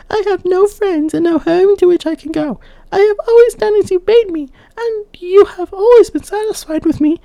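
put-on high, loud, frightened pleading girl; terrified maiden. Terrified maiden begging the old woman, high and loud. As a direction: beg not to be cast off, high and loud. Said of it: "The girl was terrified and said".

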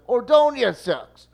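high, loud, slow mock yell; retold yell. Yankees fan bellowing back about Ordonez, high slow loud yell. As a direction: drag out the jeer at full volume. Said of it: "Then it's an".

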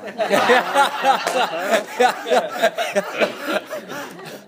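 loud laugh at his own joke; amused laugh. Real, not acted. Laughing at his own joke with the audience, loud. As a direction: laugh off the self-mockery.

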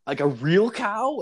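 high, disbelieving squeak; can't believe his ears; incredulous. Retold or imitated. Asking his friend in disbelief whether he stole a real cow.

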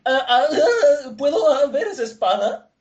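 high, loud, quavering mock nerves; nervous stammering. Vendor begging to see the customer's sword, comically nervous. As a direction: stammer the request, hammed-up and trembling. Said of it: "Y todo nervioso".

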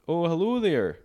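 sweet Minnesota hello; Minnesotan accent. Pretend. Mock Minnesotan greeting a traveller, Minnesotan accent. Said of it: "it's very Minnesotan".

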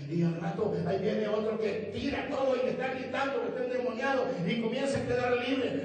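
rising from brisk telling to a high, loud shout; building preaching. Acted. Preacher telling how Samaria believed, building to full force. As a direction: build steadily to a shouted climax.

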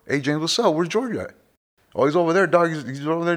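mimicking people asking.

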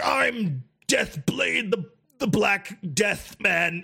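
very slow, drawn-out mock villain title; menacing orc. Orc introducing himself to the player, mock menace. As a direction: announce yourself like a grand evil orc. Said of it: "half the orcs be like".

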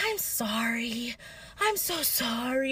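soft, slow, weak apology; barely there, just coming round; soft slow sorry from the floor; faint apology. Retold or imitated. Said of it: "what does she say to her friend".

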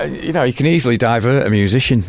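ordinary delivery

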